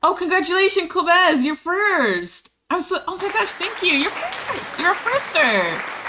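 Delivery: high, loud, squealing congratulations; excited high-pitched cheer for viewers. Real, not acted. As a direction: gush over the winners. Celebrating first-place viewers.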